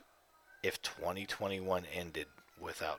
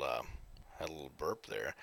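ordinary talk, nothing marked

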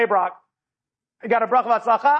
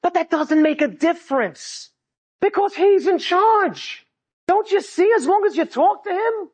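impassioned preaching; 8 semitones higher than usual. Real, not acted. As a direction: proclaim it with fervour to the crowd. Preaches the lesson at full force.

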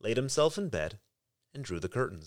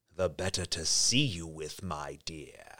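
low, soft wolf; 9 semitones lower, 4 dB quieter, slower than usual. A narrator's reading. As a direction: purr it low and sly. Wolf disguised as grandmother.

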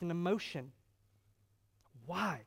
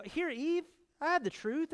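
higher, coaxing tempter; lightly voiced serpent making an offer; tempting Satan; 9 semitones higher than usual. Satan offering Eve the truth, mock coaxing.

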